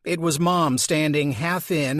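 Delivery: ordinary delivery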